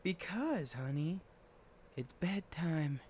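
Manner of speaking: high, soft mother's voice; mom voice. Acted. Mom calling Ophelia to bed in a high soft voice. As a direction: call the child up sweetly like a mom.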